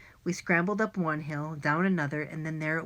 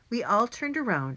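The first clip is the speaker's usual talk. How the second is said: ordinary delivery, nothing marked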